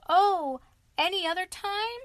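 high, loud, cheeky washerwoman. Retold or imitated. Mole in disguise teasing the sentries. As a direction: chirp it back at the haughty guard.

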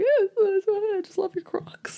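high, crying through the words; tearful high gush. Retold or imitated. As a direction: gush about the shoes while crying. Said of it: "And I'm like".

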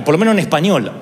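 ordinary talk, nothing marked

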